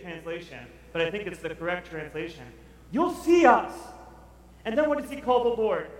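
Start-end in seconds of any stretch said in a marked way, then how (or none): 2.9-3.7 emphatic quote. Acted. Quoting the prophet emphatically.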